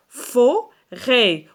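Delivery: fo... rêt, each half held; syllable-by-syllable. Shown as a demonstration. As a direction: sound out forêt in two parts. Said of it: "عندنا جوج مقاطع".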